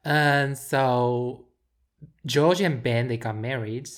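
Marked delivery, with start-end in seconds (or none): none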